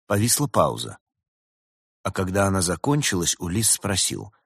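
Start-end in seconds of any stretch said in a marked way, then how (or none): none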